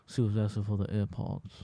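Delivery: low, soft, blurred words; mumbled reply. Retold or imitated. Housemate explaining what she asked for, low mumble. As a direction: imitate a barely intelligible mumbler. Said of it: "Dre will come out like".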